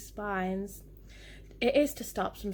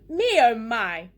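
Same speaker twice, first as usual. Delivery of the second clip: loud, drawn-out exclamation; yelling; 9 dB louder than usual. A narrator's reading. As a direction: yell it slowly, relishing each word.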